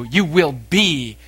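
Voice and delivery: You will be, slow with each word weighted and firm; emphatic declaration. Shown as a demonstration. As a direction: land "You will be" slowly as a firm promise. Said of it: "Emphatic declaration".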